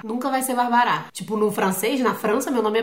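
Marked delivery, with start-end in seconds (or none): 0.5-1.1 "barbará" stressed on the closing "rá"; tônica no final. Shown as a demonstration. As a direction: land hard on the last syllable of "barbará". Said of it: "nunca a sílaba tônica é a final". ("barbará" com acento no "rá" final)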